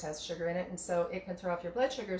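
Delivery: normal talking voice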